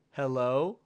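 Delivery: tentative "Hello" with a rising end; "Hello" drawn upward, answering a phone; questioning hello. A narrator's reading. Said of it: "my mother questioned".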